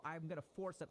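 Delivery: ordinary delivery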